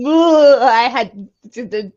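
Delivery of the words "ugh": disgusted groan. Real, not acted. Disgust at creepy character.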